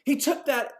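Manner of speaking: high-pitched, emphatic disbelief; astonished. Real, not acted. Cannot believe what she saw.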